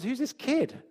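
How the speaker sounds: Goliath's taunt. Acted. Goliath scoffing at young David, a mild mocking sneer. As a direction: toss it off with lightly voiced scorn. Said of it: "he says".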